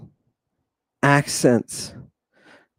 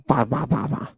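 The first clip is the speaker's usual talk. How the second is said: soft, monotone "ba ba ba" babbling; quiet mocking babble; mock barbarian babble; 4 semitones lower, 7 dB quieter, faster than usual. Shown as a demonstration. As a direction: imitate someone who can't speak Greek. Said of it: "That's what you sound like".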